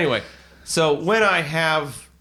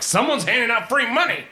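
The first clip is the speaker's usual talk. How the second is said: high, loud eager mob voice; excited crowd voice; 4 dB louder than usual. Retold or imitated. Vagrants rushing over for free money, high excited crowd. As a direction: sound like greedy strangers hyped up. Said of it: "They're like".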